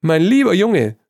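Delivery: high and loud through laughter; can barely get it out for laughing. A narrator's reading. Trevor still laughing at Hugo, loud and high. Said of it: "konnte sich vor Lachen nicht halten".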